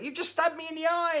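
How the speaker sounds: high, loud mock accusation; hysterical child. Child accuses hairdresser mid-cut, mock hysterical yell. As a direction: shriek it like a kid in a panic.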